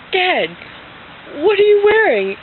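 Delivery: put-on very high, loud child's question; incredulous little kid. Little girl questions dad's costume, high-pitched disbelief. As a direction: call him out in a high kid voice. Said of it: "And I'm like".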